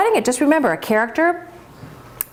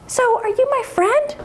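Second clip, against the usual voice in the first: very high, innocent calf voice; little calf; 11 semitones higher than usual. Acted. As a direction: naive young calf making friends. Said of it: "Bella says".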